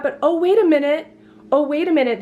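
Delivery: high-pitched feigned surprise; mock realization. Teasing viewers before the bigger reveal, high mock surprise.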